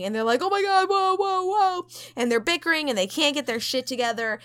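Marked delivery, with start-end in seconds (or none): 0.4-1.9 mock alarm. Crew reacting in shock.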